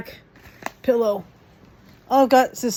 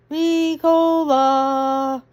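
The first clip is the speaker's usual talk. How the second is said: slow, stretched and higher; drawn-out name; 5 semitones higher, 3 dB louder, much slower than usual. Real, not acted. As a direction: stretch Ricola out playfully.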